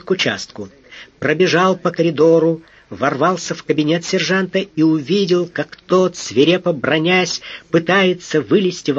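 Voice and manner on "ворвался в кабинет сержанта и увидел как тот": usual speaking voice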